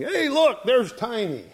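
high-pitched excited call, no real volume; mock scream. Big Carl spots Tiny coming out the door, mock screaming. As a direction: imitate a big man hollering at a sudden sighting. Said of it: "saw him and started screaming".

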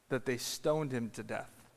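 ordinary talk, nothing marked

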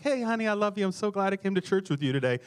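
high, lightly voiced lovey-dovey sweetheart; mock sweet couple. Preacher imitating a mild couple on the back row, mock sweet. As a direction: tease a sugary little church date. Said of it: "I'm not talking like a little".

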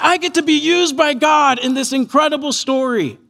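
high-pitched elated wonder; brimming, marvelling gratitude; grateful joy. Retold or imitated. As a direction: imitate Mary overjoyed at being chosen. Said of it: "so grateful and full of joy".

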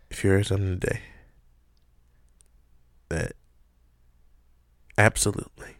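slow dramatic pauses; dramatic announcer. Acted. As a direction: draw it out for effect.

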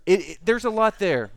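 talking through laughter; laughing. Real, not acted. Pastor laughing to the congregation about the prayer. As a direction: laugh while you say it.

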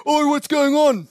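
high, loud shout from a distance; calling out. Retold or imitated. Friend Paul arriving and shouting at the cyclist, high and loud. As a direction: call out sharply to break up the fight.

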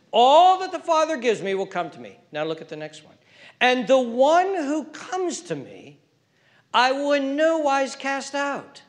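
loud, high sermon cry; full-force preaching. Real, not acted. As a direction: preach it to the back row.

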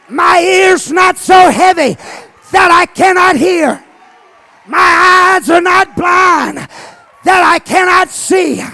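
high, loud shouted preaching; fiery revival preaching; preaching at full force. Acted. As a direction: whoop it up from the pulpit.

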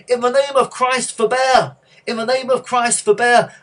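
loud, high, impassioned monk's cry; full-voiced plea to halt the fight. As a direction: shout it across a roaring arena. Monk calls from arena's edge.

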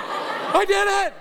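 high, slow boastful cry; hammed-up hero claim; mock triumph. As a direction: crow about it like a hero.

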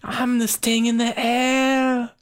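high put-on swaggering character voice; hammed-up falsetto, stretching the endings. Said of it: "I like my version better".

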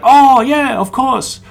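deadpan joke in a high mock voice; Danny brushing it off with a joke; imitating Danny. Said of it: "He's like".

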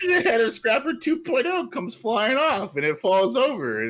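high-pitched, giggly narration; barely holding back the giggles; laughing while talking. Real, not acted. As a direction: push the story out through laughter. Still laughing through the story.

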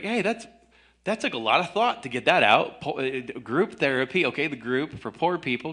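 high, sarcastic mock admiration; fake-impressed sarcasm; sarcastic mock praise. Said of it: "I was like".

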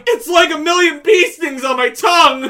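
loud, strained mock yell; pained shout. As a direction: shout it like your mouth burns. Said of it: "they can't stop laughing at me going".

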